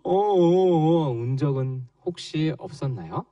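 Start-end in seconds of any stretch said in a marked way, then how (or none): 0.0-1.1 slow, louder stuttering sobs; mock sobbing. Pastor acts out a child crying for the children, with loud slow sobs. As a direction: sob it out like a small child.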